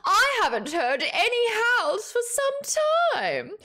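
slow, high, strained orc voice; orc character. Acted. Voiced orc line in story.

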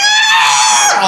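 high-pitched screeching car noise; long, high, loud "aah". Retold or imitated. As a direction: screech like a car veering off the road. Imitates car lurching off road.

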